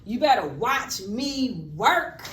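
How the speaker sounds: teacher contrasting a polite question with a demand; excited demand. Shown as a demonstration. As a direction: lean on it like you mean it. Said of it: "do I sound excited?".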